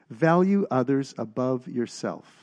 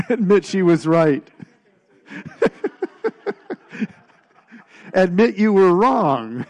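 laughing; 6 semitones higher, 9 dB louder than usual. Real, not acted. Joking answer, group laughing.

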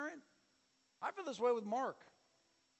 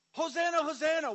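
high, loud cry of praise; crowd cheer; 8 semitones higher, 9 dB louder than usual. Retold or imitated. Crowd praising, then turning.

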